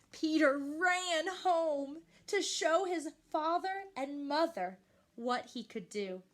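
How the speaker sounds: ordinary delivery, nothing marked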